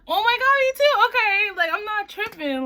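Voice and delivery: very high, loud squeal of agreement; excited relief. Retold or imitated. To sister who was also cold, excited relief. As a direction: burst out high and loud, reenacting vindication.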